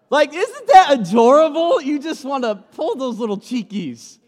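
put-on high cooing voice over a cute baby. Pastor gushing over a baby, high coo. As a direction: gush like pinching baby cheeks.

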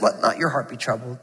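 quiet, soft and calm. Acted. Jesus calming troubled hearts, quiet and soft. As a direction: say it softly, soothing a worried listener. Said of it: "Jesus just so calmly says".

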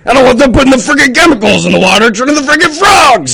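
high, loud ranting conspiracy shout; Alex Jones impression. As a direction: rant like a furious radio host. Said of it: "Do an Alex Jones".

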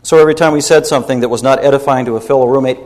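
usual speaking voice

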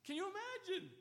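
incredulous. Real, not acted. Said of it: "preacher's incredulous exclamation".

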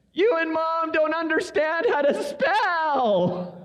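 put-on high, slow, tearful child voice; a little girl wailing at her parents. Daughter crying to her parents they can't spell, high wailing child. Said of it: "dreaded silence followed by".

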